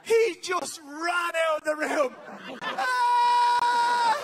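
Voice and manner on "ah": loud, high mock scream; child's terrified scream. Son fleeing the rabbit, high hammed-up scream. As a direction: scream up high like a frightened kid.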